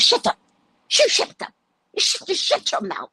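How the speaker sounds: high, stammering imitation of an irate woman; acted-out quarrelsome outburst; mock angry retort. Acted bus quarrel, woman shouting back.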